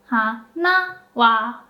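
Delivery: slow "Hana wa", particle falls; odaka pitch. Shown as a demonstration. Teacher repeating the falling particle on hana wa. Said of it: "So wa goes down".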